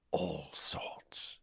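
very soft, low whisper; breathed. A narrator's reading. Boy answering what he can do, breathed. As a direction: breathe it out, barely voiced. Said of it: "breathed Riddle".

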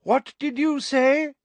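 very high, slow demand to repeat; high, patronizing question; loud hard-of-hearing query. A narrator's reading. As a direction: enunciate loudly as if to someone slow. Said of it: "cupping her hand around her ear".

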